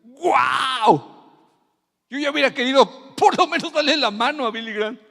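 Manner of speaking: high, loud choked cry. Retold or imitated. Preacher voicing the weeping man, choked and loud. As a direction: burst out with a lump in the throat. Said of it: "con un nudo en la garganta".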